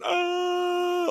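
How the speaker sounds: very high hammed-up scream; mock scream. Retelling the doctor grabbed by the patient, a high mock scream. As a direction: squeal like the terrified doctor.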